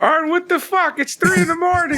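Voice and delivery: high, incredulous mock Cody; Cody voice, high pitched. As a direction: squeak it out like a startled Cody woken at night. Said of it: "it was like".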